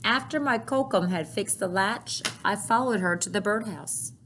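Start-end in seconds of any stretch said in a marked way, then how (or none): none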